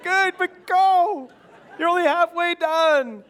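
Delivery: slow, high, loud urging shout; urging him on. Retold or imitated. Dad calling to son not to slow down, loud and slow. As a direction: push the runner on, drawn out and emphatic. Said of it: "I'm like".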